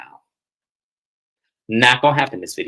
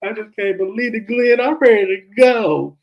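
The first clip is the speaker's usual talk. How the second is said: mock crying; 10 semitones higher than usual. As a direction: imitate a tearful complaint, stretching the words. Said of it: "Letitia is crying. She goes".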